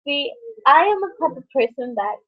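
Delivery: normal talking voice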